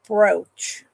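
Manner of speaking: ordinary delivery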